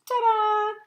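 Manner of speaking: high, loud showman's ta-da; triumphant ta-da. Acted. Cheese showing off to the audience, triumphant. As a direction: announce yourself like a magician's reveal.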